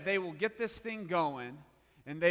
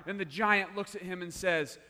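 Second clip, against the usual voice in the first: normal talking voice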